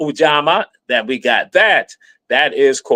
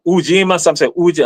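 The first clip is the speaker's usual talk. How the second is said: usual speaking voice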